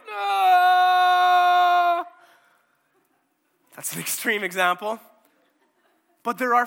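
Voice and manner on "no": high, loud wail of sorrow; anguished cry. Retold or imitated. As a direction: cry out, overwhelmed with grief. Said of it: "they walk in like".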